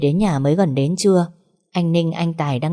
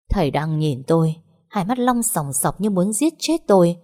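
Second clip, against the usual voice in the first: ordinary delivery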